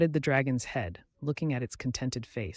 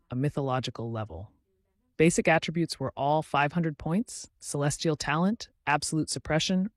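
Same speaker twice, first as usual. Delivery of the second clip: plain reading voice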